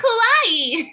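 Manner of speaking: high squealed kawaii; anime girl voice. Acted. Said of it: "The anime girl voice".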